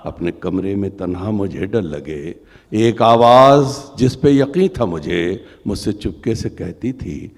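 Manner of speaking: loud declaiming poem voice; stately nazm recitation. Acted. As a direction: recite with rising emotion.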